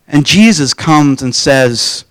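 ordinary talk

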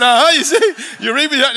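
high, loud mock gloat; gloating retort. Dad crowing back at his frightened daughter, mock gloating.

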